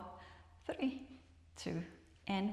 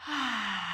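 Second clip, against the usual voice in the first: breathy unvoiced "Ah" on the out breath; just breathe the "Ah" out; whispered sigh; 2 semitones higher than usual. Shown as a demonstration. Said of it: "and say".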